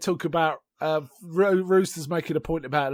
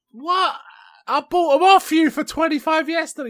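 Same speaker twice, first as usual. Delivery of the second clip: much higher-pitched mock disbelief; retold incredulity; 13 semitones higher, 6 dB louder than usual. Retelling a haggle over ponies bought yesterday, incredulous. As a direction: protest in a high, astonished voice.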